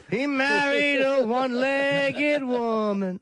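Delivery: high, loud and slow, hammed-up; mock announcement. Joking to the hosts, a hammed-up high loud slow line. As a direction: belt it out like a big reveal.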